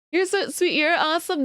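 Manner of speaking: ordinary delivery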